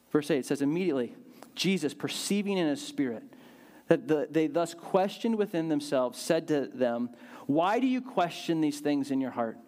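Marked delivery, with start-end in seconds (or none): none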